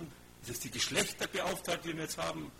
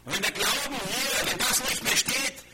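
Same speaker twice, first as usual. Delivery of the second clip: preaching climax; 5 semitones higher, 7 dB louder than usual. Real, not acted. Preacher builds to full force.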